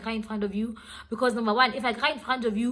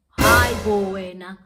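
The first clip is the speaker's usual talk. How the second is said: loud, drawn-out exclamation; indignant outburst; 12 dB louder, slower than usual. Real, not acted. Storyteller exclaiming at boyfriend's fifty percent speech, indignant outburst. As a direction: burst out loudly, stretching the words.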